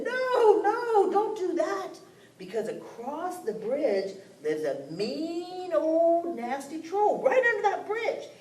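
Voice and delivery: high, urgent warning; alarmed friends. Acted. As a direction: cry out to stop them, frightened. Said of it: "all their other animal friends said".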